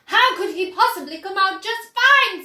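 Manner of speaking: loud, high, angry retort; mother snapping at the chocolate maker; snapped. A narrator's reading. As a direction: bite back at him. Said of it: "snapped Missus Gloop".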